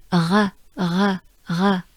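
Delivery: "R" exhaled from the throat; French R. Shown as a demonstration. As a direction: keep the tongue down for each "R". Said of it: "Make sure your tongue stays down".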